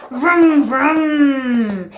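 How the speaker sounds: vroom. Shown as a demonstration. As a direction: make a toy car sound for a child. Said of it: "play with your toy car".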